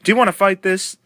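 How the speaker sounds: challenging. Retold or imitated. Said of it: "Eddie Marlin said".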